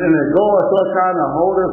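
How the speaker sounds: normal talking voice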